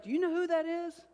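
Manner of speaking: hammed-up amazed waitress. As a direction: gasp it out like a starstruck waitress.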